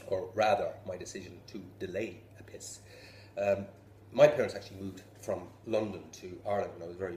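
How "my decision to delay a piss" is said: hushed conspiratorial whisper; whispered aside. Acted. Comic confessing to the crowd in a whisper. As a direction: drop to a whisper for the punchline.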